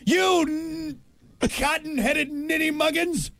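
put-on high, theatrical old-timey insult; hammy insult. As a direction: overplay it like a stage villain.

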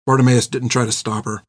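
plain reading voice

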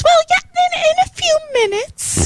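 mock high-pitched, hesitant server; server's high voice. Server admits to late diners they close soon, high mock squeak. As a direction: squeal it as an awkward young server.

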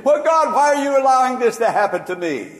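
high, loud, fast whine to God; hammed-up complaint to God; mock complaining plea. Mock complaint put in Paul's mouth.

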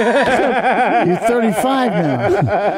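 very slow, laughing tease. Real, not acted. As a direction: drag it out while laughing. Teasing, laughing.

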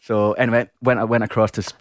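usual speaking voice

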